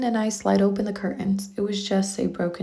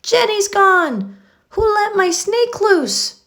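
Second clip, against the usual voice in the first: high, loud, slow frantic yell; shouting in panic; 12 semitones higher, 6 dB louder, slower than usual. Acted. Emily yells to the class that her snake is loose. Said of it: "Emily shouts".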